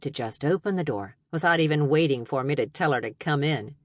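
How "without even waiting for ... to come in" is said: ordinary delivery, nothing marked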